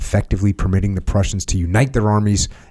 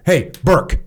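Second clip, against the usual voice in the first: dismissive browbeating boss; 4 semitones higher, faster than usual. Retold or imitated. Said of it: "that little act".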